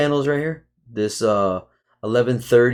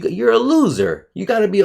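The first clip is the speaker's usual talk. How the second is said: angry rant; 8 semitones higher than usual. Real, not acted. Ranting at viewers.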